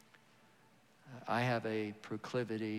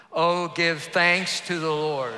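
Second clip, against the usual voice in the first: much louder, slow proclaiming; 6 semitones higher, 14 dB louder, slower than usual. Shown as a demonstration. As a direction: lift it up, louder and slower. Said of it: "Say it louder".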